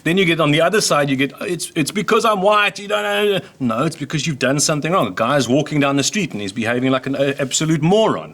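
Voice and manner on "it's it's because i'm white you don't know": mock complaint.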